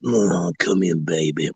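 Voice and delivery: hammed-up low seduction; seductive husband. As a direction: lure her in like a sleazy husband.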